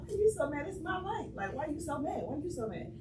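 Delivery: high-pitched, lightly acted complaint; mild retold teenage protest; mock protest. Retold protest to angry mother.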